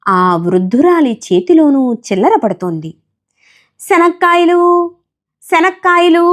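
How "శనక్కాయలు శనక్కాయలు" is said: peanut seller's cry. Acted. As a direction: sing out the peanuts to every bench. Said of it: "ఆమె అరుస్తూ". (బఠానీలు అమ్మేవాళ్ళ పిలుపులా రాగంగా అరుపు)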